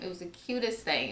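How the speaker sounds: normal talking voice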